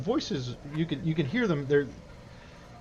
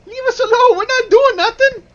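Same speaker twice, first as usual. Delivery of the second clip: put-on high, loud child's voice; shrieky kid fending off strangers; Jinx; 11 dB louder than usual. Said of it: "And Jinx says".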